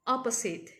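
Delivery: "opposite" as "OP-uh-sit", short last vowel; correct pronunciation. Shown as a demonstration. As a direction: model "opposite" clearly with an ending like "sit". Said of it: "the correct way of pronouncing it".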